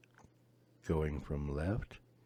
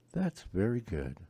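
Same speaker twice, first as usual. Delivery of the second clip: ordinary talk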